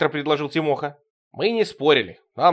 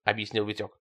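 ordinary delivery, nothing marked

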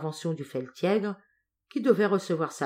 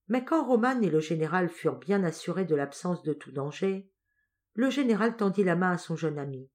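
usual speaking voice

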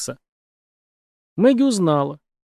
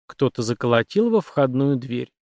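usual speaking voice

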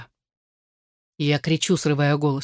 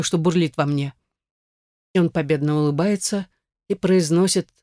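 plain reading voice

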